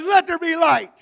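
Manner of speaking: God proclaiming. Acted. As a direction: proclaim it high, in a preacher's ringing call. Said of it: "He says".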